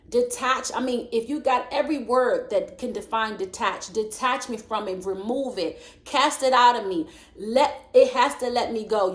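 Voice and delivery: preaching. Acted. Preacher urging listeners to pray, rising. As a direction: urge them to cry out to the Lord.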